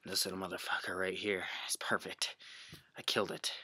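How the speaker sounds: soft, low hushed whisper; survival whisper. Acted. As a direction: whisper so the monkeys can't hear.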